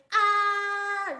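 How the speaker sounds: high, loud, sustained level "Ah"; loud roller-coaster cry. Shown as a demonstration. Teacher mimics a roller coaster rider, crying out loudly. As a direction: cry it out long and even. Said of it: "really, really loudly".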